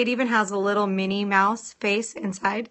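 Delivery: usual speaking voice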